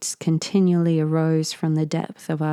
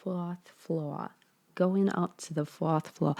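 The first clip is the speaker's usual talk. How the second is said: usual speaking voice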